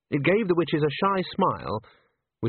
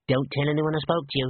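lightly squeaky man, confiding; a hint of a squeak as you confide; 2 semitones higher than usual. A narrator's reading. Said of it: "in a squeaky voice".

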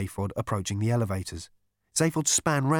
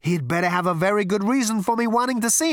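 high, loud irritated outburst; exasperated threat; 10 semitones higher, 6 dB louder than usual. A narrator's reading. Zaphod vowing to confront him, exasperated. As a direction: build into an indignant threat.

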